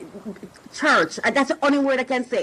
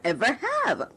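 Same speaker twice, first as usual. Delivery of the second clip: ordinary talk, nothing marked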